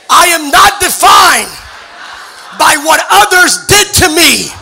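loud, slow, deliberate creed; a congregation told to repeat, declaring each phrase; declaration with conviction. Shown as a demonstration. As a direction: proclaim every line slowly and loud. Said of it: "say it with every fiber of your being".

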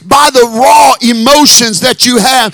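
loud, rising preacher's cry over music; full-force preaching. Acted.

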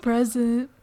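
put-on loud, rising kid's roll-call answer; elementary school boy. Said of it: "That's my impression of an elementary school boy".

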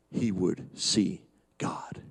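hushed whisper, almost no voice; awed whisper of a promise. Acted. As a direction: breathe it out softly in awe. Quoting Job's hope, hushed.

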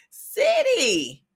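City sung out high and bright; playful exclaim. Real, not acted. Joking about her dream Nap City shirt, playful high exclaim. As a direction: toss the word up high like a punchline.

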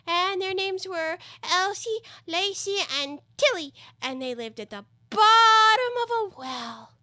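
high, slow little creature voice; sleepy dormouse storyteller. Acted. Dormouse tells of three sisters, in a high creature voice. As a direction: tell it in a high, drawn-out squeak.

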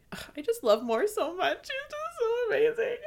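gushing through tears, voice jumping high; laughing and tearing up at once; tearful laugh. Real, not acted. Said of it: "gone all deep and emotional".

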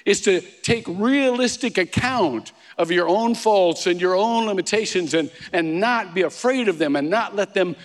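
pulpit-pounding sermon delivery; full-force preaching. Acted.

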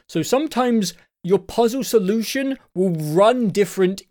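ordinary talk, nothing marked

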